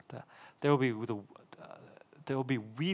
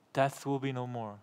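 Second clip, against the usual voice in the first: normal talking voice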